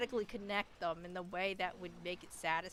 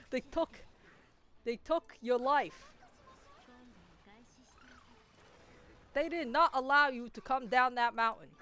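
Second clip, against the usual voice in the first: Bernie Sanders impression; 2 semitones higher, 4 dB louder than usual. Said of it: "Well said, Senator".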